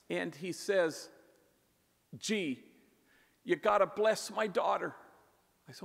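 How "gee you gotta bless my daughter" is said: a touch of panic, pitched higher and louder; panicked plea. Retold or imitated. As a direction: retell his panicked call, urging. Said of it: "a little bit panicked in his voice".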